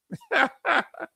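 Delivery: real high-pitched chuckle; short burst of high laughter; amused laugh. Real, not acted. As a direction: laugh at your own story. Laughs at own Southern accent story.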